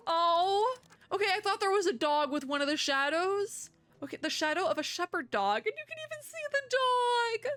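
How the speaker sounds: sudden high-pitched "oh, okay" with a rising squeal; surprised realisation. Real, not acted. Suddenly realises Tervel is a dog.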